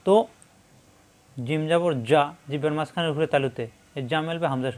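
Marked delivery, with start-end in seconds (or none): none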